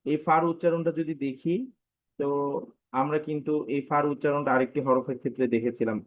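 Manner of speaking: normal talking voice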